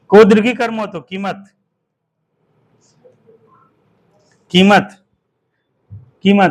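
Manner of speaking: loud spelled-out dictation; dictation call. Shown as a demonstration. Teacher dictating spelling to pupils, loud. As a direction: call each word out clearly. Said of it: "चलो अगला लिखो".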